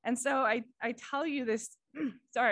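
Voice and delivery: usual speaking voice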